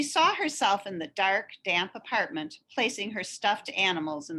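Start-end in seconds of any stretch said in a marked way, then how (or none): none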